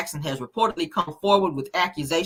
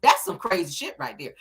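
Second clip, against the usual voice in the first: normal talking voice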